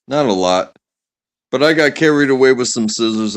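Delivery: ordinary delivery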